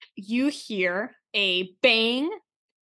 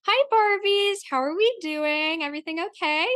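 cheery supervisor greeting; 8 semitones higher than usual. Acted. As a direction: greet the staff like a bubbly boss. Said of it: "she goes".

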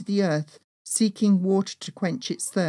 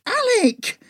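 much higher, louder second call; angry repeated call, pitched way up; furious shout, rising; 6 dB louder than usual. Acted. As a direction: yell it higher, losing patience. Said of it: "I shouted in fury".

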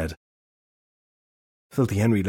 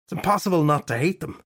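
annoyed inner thought; 7 semitones higher than usual. A narrator's reading. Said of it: "the fairy detective thought".

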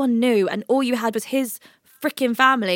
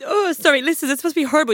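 crying; 7 semitones higher than usual. Real, not acted. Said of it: "burst out crying 'cause you've started crying".

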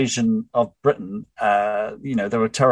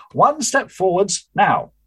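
high, slow firm command; commanding order; 9 semitones higher, slower than usual. Acted. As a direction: drive them forward. Charlotte orders a step.

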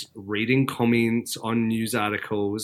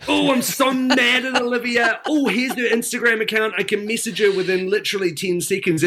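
mock outrage; 8 semitones higher than usual. Viewer furious at a contestant, ranting. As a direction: rant like an angry reality TV viewer. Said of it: "you're going".

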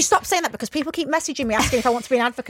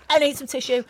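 ordinary delivery

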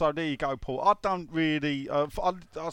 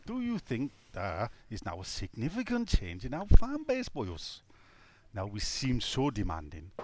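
hammed-up Welsh lilt, pitch swinging; mock Welsh accent; 3 semitones lower than usual. Reading a Welsh listener's question aloud, mock Welsh accent. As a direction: read it out in a put-on Welsh voice. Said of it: "Myrion Williams is obviously Welshian".

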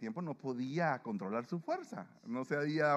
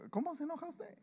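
playful high question; 4 dB quieter than usual. Acted. Preacher asks congregation playfully.

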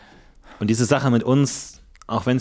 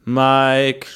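calling out; 2 semitones higher, much slower than usual. Acted. Ghost calling Mike repeatedly.

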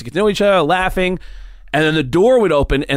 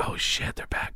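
panicked whisper; 12 dB quieter than usual. Retold or imitated. Jacked on coke, spotting the girls coming back, whispering. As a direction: hiss it under your breath.

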